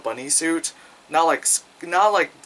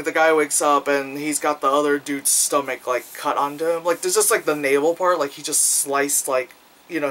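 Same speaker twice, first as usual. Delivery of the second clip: ordinary delivery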